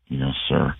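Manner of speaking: subdued "Yes, sir." from a worn-out team; low mumbled reply. Retold or imitated. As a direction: answer like a tired, sheepish player. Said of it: "Like, very low".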